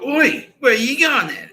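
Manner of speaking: high, loud, slow "Oi" of a suspicious guard; guard calling out. Retold or imitated. Guard calls out on walkway.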